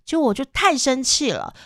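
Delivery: ordinary talk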